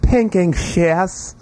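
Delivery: put-on British accent. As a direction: stretch it out in an exaggerated British accent. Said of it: "said in your best British accent".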